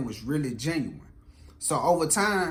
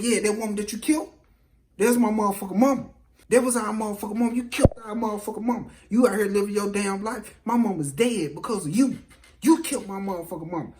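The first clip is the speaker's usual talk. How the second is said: fast, higher-pitched retold tirade; acted angry accusation; 5 semitones higher, 3 dB louder, faster than usual. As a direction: hurl the accusation like a grieving daughter. Daughter accuses him of killing mother.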